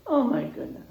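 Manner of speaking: plain reading voice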